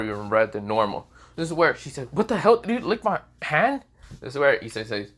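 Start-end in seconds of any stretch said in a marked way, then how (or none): 2.1-3.8 high, loud startled question; shocked outrage. Acted. Valerie whose hand was just licked, shocked outrage. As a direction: jump up high in disbelief.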